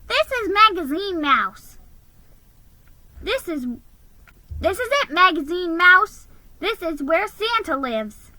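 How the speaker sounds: high, bright eager elf. A narrator's reading. Elf telling the mouse they have arrived, high and eager. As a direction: announce it proudly as a little elf. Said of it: "the elf said".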